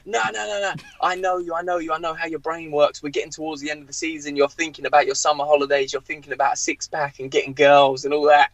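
acted-out accusing rant; seething manager. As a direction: imitate an angry boss dismissing every excuse. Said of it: "seething, ... breathing fire".

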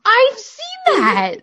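high, loud, drawn-out squeal; excited recognition. Real, not acted. Co-host recognises the silly streams, excited squeal. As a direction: cry it out slowly in delighted recognition.

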